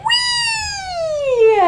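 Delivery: very high, squealing frog "Wee"; frog's happy cry. Acted. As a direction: let the frog squeal with joy. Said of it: "Frog said".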